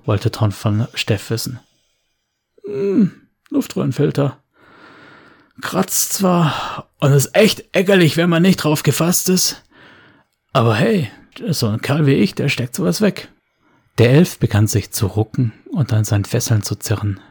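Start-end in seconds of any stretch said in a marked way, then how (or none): 2.7-13.3 Steff's voice. Acted. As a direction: brag it off lightly. Steff brags.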